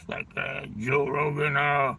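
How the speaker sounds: halting, strained impression, soft and full of uhs; strained roid voice. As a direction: imitate a croaky, labored talker. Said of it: "he talks like this".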